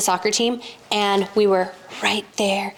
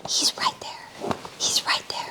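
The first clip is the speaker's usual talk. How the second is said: awed whisper; 8 dB quieter, slower than usual. Retold or imitated. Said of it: "I was just like".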